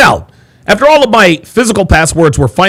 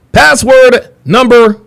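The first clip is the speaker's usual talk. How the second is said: big announcement; 7 semitones higher, 4 dB louder, slower than usual. Acted. Dramatic countdown announcement.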